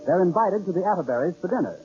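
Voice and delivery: normal talking voice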